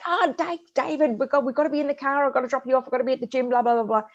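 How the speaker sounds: high, rushed mock scream at her son; mother hurrying her son, a mock frantic yell; retold frantic yell. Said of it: "And I'm screaming at David".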